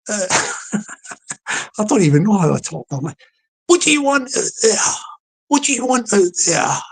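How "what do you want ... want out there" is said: higher-pitched London doorstep challenge. Acted. Resident behind the door to the caller, London accent. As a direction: call it out high in a London accent. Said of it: "I'll do it with my sort of London voice".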